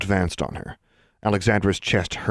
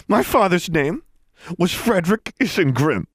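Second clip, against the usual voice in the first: high, heated accusation; rising voice; 11 semitones higher, 4 dB louder than usual. A narrator's reading. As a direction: let anger lift the voice. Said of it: "Harriet's voice rose".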